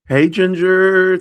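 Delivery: bright loud call to a visitor; loud hi to someone arriving. Real, not acted. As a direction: call out hello across the room. Greeting someone walking in.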